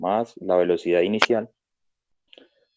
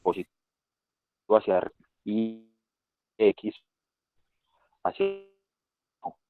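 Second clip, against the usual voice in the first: choppy cutting-out audio; words dropping out over a bad connection; sonido cortado. Real, not acted. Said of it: "lo escucha como cortadito". (voz entrecortada por mala conexión)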